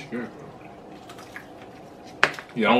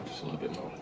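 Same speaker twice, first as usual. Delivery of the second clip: whisper; 5 semitones lower, 14 dB quieter, faster than usual. Real, not acted. Cheese pull moment.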